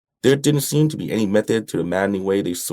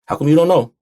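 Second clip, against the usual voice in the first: loud, high exasperated demand; pitched-up, louder indignant question; raised voice; 8 semitones higher, 8 dB louder than usual. A narrator's reading. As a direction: raise your voice to challenge him. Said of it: "I raised my voice".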